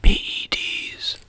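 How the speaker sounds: hissed, slow conspiratorial offer; loud stage whisper. Acted. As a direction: breathe it out slow like a secret. Slyly suggesting steroids.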